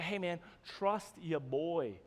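slow, low, soft smooth-talk; mock reassurance. Joker to his friends before a crazy stunt, reassuring. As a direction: ease it out slowly to reassure a doubting buddy.

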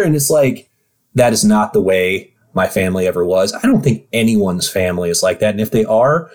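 usual speaking voice

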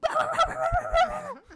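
loud, very high rapid dog noise; imitated dog noise. Owner imitating the dog as the cousin panics, high frantic noise. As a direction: mimic a frantic little dog at full pitch. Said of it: "And my dog's going".

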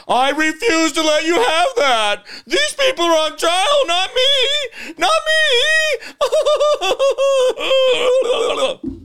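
high, loud, slow hammed-up wailing protest; mocking her outburst. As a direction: mimic her shrill indignant wail. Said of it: "How dare you question her?".

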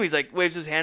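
ordinary talk

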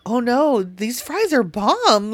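loud, high, drawn-out raving; retold excited protest. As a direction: quote yourself gushing, loud and high. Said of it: "I go".

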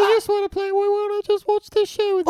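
squeaky high pleading parody; hammed-up high little voice; mock younger self. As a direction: pretend to be a tiny innocent version of yourself. Parody of their younger selves.

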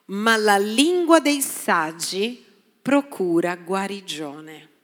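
slow, deliberate Bible reading; solemn scripture reading. Acted. Pastor reading Proverbs to the church, slow and solemn. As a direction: read each phrase slowly, weighty.

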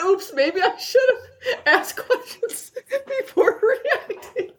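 laughing. Retold or imitated. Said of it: "she said".

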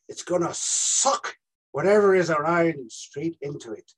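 halting Irish detective explaining the case; measured Irish accent with dramatic pauses. Acted. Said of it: "You play an Irish detective".

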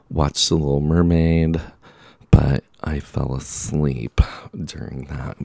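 ordinary talk, nothing marked

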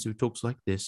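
soft, soothing British accent. Shown as a demonstration. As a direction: slip into a gentle British accent like the YouTube teacher. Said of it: "He's got a British accent".

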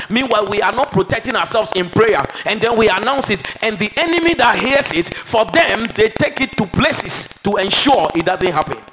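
loud, rising sermon climax; fervent pulpit cry; preaching at full force. Acted. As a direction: preach it hard and high, building. Preaching builds to a peak.

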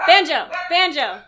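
sharp loud call of the dog's name. Real, not acted. Owner yelling at her barking dog, loud and sharp. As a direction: yell the dog's name to cut through barking.

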